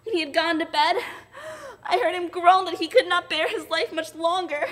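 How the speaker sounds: high, loud and sobbing; child sobbing over her grandfather; breaking into tears. Acted. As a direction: let the voice break into crying. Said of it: "burst into a passion of tears".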